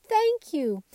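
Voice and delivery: bright, loud and high thank you; shouted thanks. A narrator's reading. Children thank the dolls, shouting happily. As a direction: call out thanks up to the window. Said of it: "laughed and shouted".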